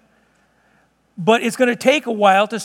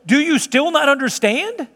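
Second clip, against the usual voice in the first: higher, incredulous question; apoplectic; 4 semitones higher than usual. Acted. Jesus to disciples who forgot the loaves, apoplectic. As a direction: ask it with rising exasperation, pitched up. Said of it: "I think Jesus was probably apoplectic".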